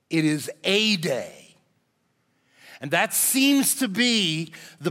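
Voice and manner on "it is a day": "It is a day" slowed, leaning on "a"; each word set apart, landing the point; slow and deliberate; slow emphatic stress. Acted.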